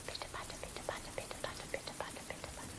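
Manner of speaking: rhythmic whisper like soft rain. Pretend. Teacher whispers the rain sound to the children. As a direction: tap the words out in a whisper.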